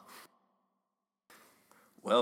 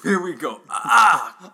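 pained yelp; 7 semitones higher, 14 dB louder, slower than usual. Acted. Cutting palm with knife.

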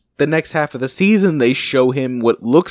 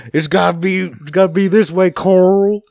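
imitated accented father figure; 2 semitones higher than usual. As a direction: imitate the actor, stretching Carl into "coral". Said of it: "comes out sounding like coral".

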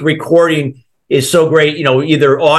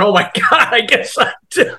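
high, laughing realization; sheepish admission; 8 semitones higher than usual. Retold or imitated. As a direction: concede it with a laugh. Hearing recording of own snoring.

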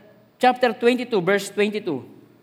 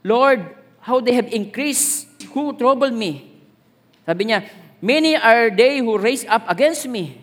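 slow, louder solemn reading of a psalm; scripture reading; 4 dB louder, slower than usual. Acted. Preacher reading Psalm 3 aloud to the church, slow and solemn. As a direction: proclaim each verse slowly to the church.

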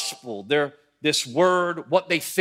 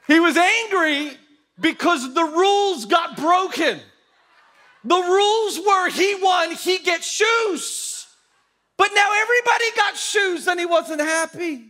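comic indignant shouting; 11 semitones higher, 3 dB louder than usual. Real, not acted. Preacher explaining Albie's broken rules, high comic shouting. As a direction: shout the grievance as a mock tirade.

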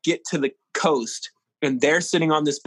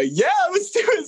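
laughing agreement. Retold or imitated. Kids agreeing to selfie.

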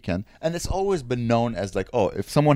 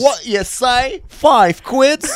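high, loud, drawn-out British query; mock British accent; 13 semitones higher, 6 dB louder, much slower than usual. Joining a British rap parody, hammed-up British accent. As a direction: squawk it as a British street kid.

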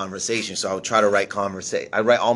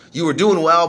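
mock yell from the manager; 9 semitones higher, 9 dB louder than usual. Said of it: "like, mad at me".

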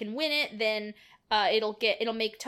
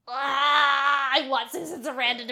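emotional outburst; 3 semitones higher, 7 dB louder than usual. Real, not acted. Podcaster moved by the film, loud emotional cry. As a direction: burst out, overwhelmed. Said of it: "getting choked up".

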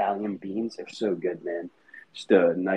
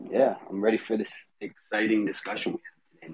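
ordinary talk

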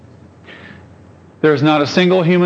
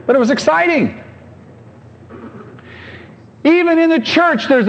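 much higher, ringing preacher's lift; excited preaching; 12 semitones higher than usual. Real, not acted. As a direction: lift the congregation with it. Preacher rising to a peak.

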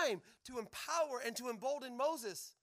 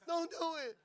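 desperate pleading; 4 semitones higher, 8 dB louder, much slower than usual. Retold or imitated. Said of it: "He's like".

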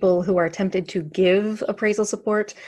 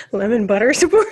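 laughing; 3 semitones higher, 8 dB louder than usual. Real, not acted. Joke list, laughing.

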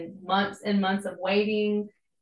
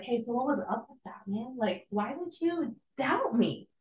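gentle reproach; 2 semitones higher, 5 dB quieter than usual. Retold or imitated. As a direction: ask it kindly, like a gentle nudge between friends. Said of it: "he's like".

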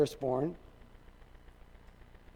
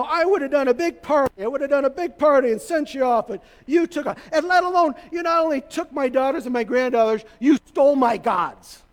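acted-out indignant tirade, higher-pitched; Laban; 5 semitones higher, 3 dB louder than usual. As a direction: rant like a wronged father-in-law, building to the accusation. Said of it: "and he's furious".

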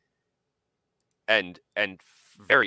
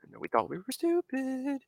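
much higher, put-on voice; mock narrator; 13 semitones higher, 4 dB quieter than usual. Quoting history video narrator, high and silly. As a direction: squeak it like a cartoon narrator. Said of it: "he's like".

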